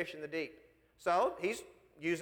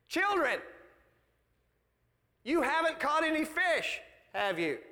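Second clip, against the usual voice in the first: calling to fishermen; 5 semitones higher than usual. Acted. Jesus to the fishermen from the shore, calling out. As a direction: call out high, then drop on "have you".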